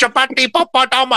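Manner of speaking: mock Indian accent, high-pitched and overblown; bad Indian accent. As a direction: imitate a fan's terrible Indian accent. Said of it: "doing a really bad Indian accent".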